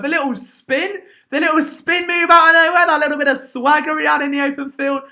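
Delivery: high, rising fan excitement; excited raving. Real, not acted. Fan raving about a player's swagger, excited. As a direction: gush over it, pitched right up.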